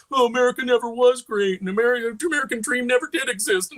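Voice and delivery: mock a hero moaning about his country; whiny Captain America. Mocking how the new Captain America complains, whiny parody voice. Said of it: "he's gonna whine about".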